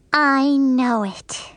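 slow, stuck-up reply; smug. A narrator's reading. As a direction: drag it out smugly. Lucille smugly agreeing.

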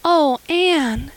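high, breathless cry of relief; gasped with relief. A narrator's reading. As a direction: gasp her name, overwhelmed with relief. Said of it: "gasped Diana".

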